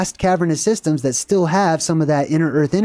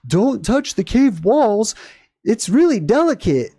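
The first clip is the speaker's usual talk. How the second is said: slow, higher, prissy cave-guide warning; mock tour guide; 5 semitones higher, slower than usual. Mocking the cave tour guide's warning to visitors, slow and high. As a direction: parody the tour guide's careful rule. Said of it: "one of the first things they say is".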